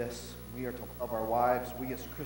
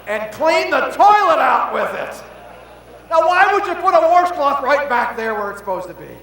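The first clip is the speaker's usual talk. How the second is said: loud, high, slow shout; preacher yelling the punchline to the crowd; 13 semitones higher, 21 dB louder, slower than usual. Acted. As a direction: belt the punchline out.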